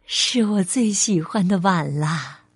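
low, tender, contented voice; smiling mother. Acted. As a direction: treasure a child's gift aloud. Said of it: "笑着说".

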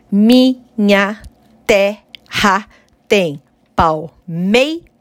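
"Minha terra tem palmeiras" slowly split into poetic syllables. Shown as a demonstration. Teacher to students, scanning the verse slowly, syllable by syllable. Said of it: "Atenção ao som".

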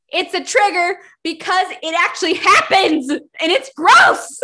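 high, loud rant climbing to a yell; disgusted outburst. Real, not acted. Podcaster revolted by cousin romance, disgusted yell. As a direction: build to a shouted protest.

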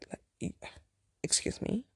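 unvoiced, offended excuse me; breathy offended disbelief; offended whisper. Offended reaction to crude line.

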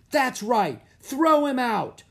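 high, loud jeering shriek; woman shrieking. A narrator's reading. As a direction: shriek it from the stands. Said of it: "a woman shrieked".

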